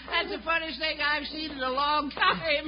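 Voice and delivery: high, wheezing cackle through the words; laughing hard. Acted. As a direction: crack up at the prank. Laughing at his prank.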